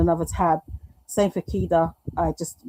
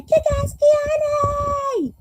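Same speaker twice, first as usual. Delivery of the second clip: high, slow, drawn-out called name; mock scream of a chat member's name; 4 dB louder, much slower than usual. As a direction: call the name out long and high. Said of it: "There was me screaming".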